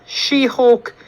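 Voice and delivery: scoffing. Acted. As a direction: ridicule the name outright. Kid mocks the name again.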